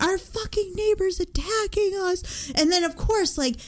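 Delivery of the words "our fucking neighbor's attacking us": panicked. Retold or imitated. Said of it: "I'm just like".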